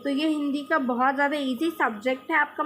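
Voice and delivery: normal talking voice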